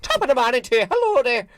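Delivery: high, lilting stage-Irish greeting; very high mock Irish accent. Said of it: "Okay, that's Irish".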